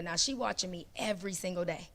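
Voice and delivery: ordinary talk, nothing marked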